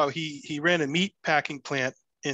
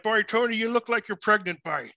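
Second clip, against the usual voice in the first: put-on teasing Tony in a higher Newfie accent; 7 semitones higher than usual. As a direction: rib your son in a playful Newfoundland twang. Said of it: "in his Newfie accent".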